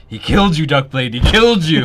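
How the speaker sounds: loud, rising alarm in character. Acted. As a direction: tell him he was killed, shaken. Character warns of vampire presence.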